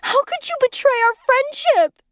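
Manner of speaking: hysterical accusation. A narrator's reading. Wife confronts friend over supposed affair.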